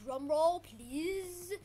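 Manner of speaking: high, stretched-out announcer call; showman's build-up. Pretend. Player teasing the arrival to viewers, showman's build-up. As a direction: draw it out to build suspense.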